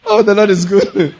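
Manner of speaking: ordinary talk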